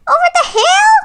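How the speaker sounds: Tweed pretends to lament; wailed. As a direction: wail it out like a distraught hen. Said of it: "wailed Tweed".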